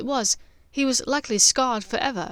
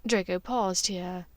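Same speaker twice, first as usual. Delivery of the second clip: plain reading voice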